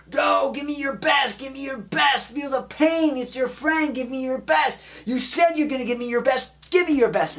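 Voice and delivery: high, urgent sideline shouting; relentless high-pitched mock coach shouts; coach yelling. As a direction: drive the player on like a coach yelling him through pain. Acting out coach yelling at crawler.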